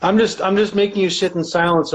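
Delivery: usual speaking voice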